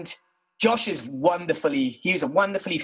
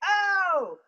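mock yelp of pain; 13 semitones higher than usual. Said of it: "what Josh sounds like when you hit him with a stick".

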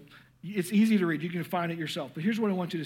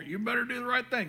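strict parent; 6 semitones higher, faster than usual. Retold or imitated. Parent too strict, mocking tone.

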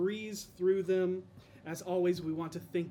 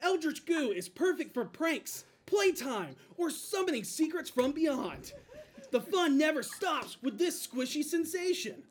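toy commercial announcer; 8 semitones higher, 4 dB louder than usual. Pretend. Mock toy commercial continues.

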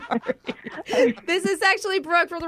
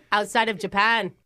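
broad cartoon Aussie; hammed-up Australian chat; fake Australian accent. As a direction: put on a cocky Australian accent. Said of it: "Are you from Australia?".

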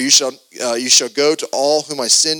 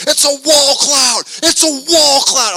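high, drawn-out yell imitating excited kids; mock alarmed shout; 12 semitones higher, 7 dB louder, slower than usual. Panicked kids spotting a storm cloud.

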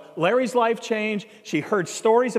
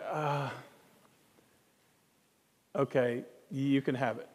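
low, soft, resigned giving in; imitating a deflated, hesitant concession; retold reluctant surrender; 7 semitones lower, 9 dB quieter than usual. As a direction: give in reluctantly, under your voice. Retold student reluctantly gives in.

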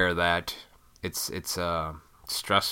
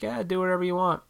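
high, fast, careless parent voice; mocking permissive parents; 11 semitones higher, 4 dB louder than usual. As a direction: toss it off like parents who never say no. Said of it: "People are just like".

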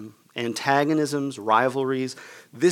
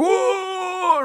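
high, wailing imitated moan; long hammed-up wail of pain; 3 dB louder than usual. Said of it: "he's going".

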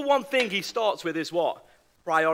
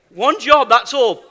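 normal talking voice

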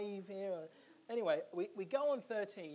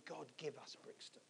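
hushed, whispery plea to God; quiet, earnest petition; retold prayer; 5 semitones lower, 10 dB quieter than usual. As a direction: pray it very softly, almost whispering. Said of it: "I was praying".

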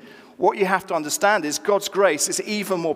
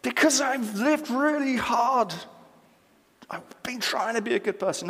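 higher-pitched imitated protest; retold sincere grievance; 7 semitones higher, 4 dB quieter than usual. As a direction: plead the case as an aggrieved churchgoer, pitched up. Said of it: "This, this was sincere".

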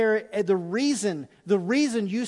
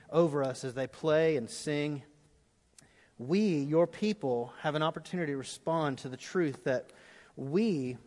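prayer; 4 semitones lower, 4 dB quieter than usual. Acted. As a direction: pray quietly as the band comes in. Closing prayer over music.

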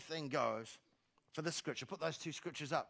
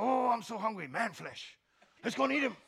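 higher, louder growling orc craving man flesh; hungry orc; 5 semitones higher, 5 dB louder than usual. Pretend. Preacher playing a hungry orc for his congregation, hammed up. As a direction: ham up a greedy Lord of the Rings monster. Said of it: "It's off Lord of the Rings".

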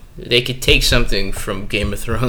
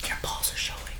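very soft voiceless whisper; joking whisper; 18 dB quieter than usual. Pretend. Friend slipping in a silly line, whispering. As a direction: whisper it like a secret aside.

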